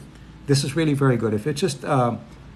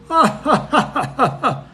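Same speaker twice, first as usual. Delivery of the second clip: much higher, loud forced "ha ha ha"; fake laugh; 11 semitones higher, 4 dB louder than usual. Shown as a demonstration. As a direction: put on a hollow polite laugh. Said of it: "not real laughter".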